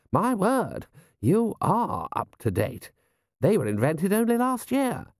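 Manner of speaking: high, swooping lordly voice; impressed lord. Acted. Lord admiring the general's new telescope, impressed. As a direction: marvel in a high-pitched character voice.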